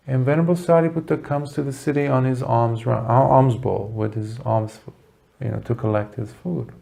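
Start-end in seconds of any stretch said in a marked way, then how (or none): none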